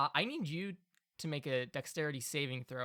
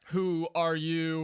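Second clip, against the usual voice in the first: slow, louder golem question; 3 semitones higher, 6 dB louder, slower than usual. Acted. Golem asks.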